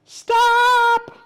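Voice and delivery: very high, loud yell; piercing storybook cry; shouted halt. Acted. Character halts the fleeing animals.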